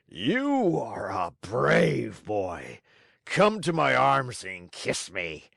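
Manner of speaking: slow, lightly voiced gruff kindness; a moved showman; softened Fire Eater. A narrator's reading. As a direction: Fire Eater embracing Pinocchio.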